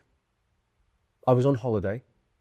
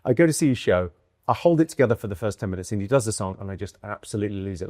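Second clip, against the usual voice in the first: ordinary delivery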